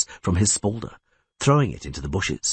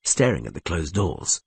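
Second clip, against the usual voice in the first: ordinary delivery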